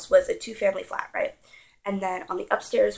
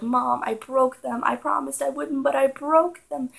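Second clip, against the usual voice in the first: higher, louder mock-tearful confession; retold crying; 6 semitones higher, 5 dB louder than usual. Child confessing to mom about breaking the figurines, mock-tearful. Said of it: "I cried".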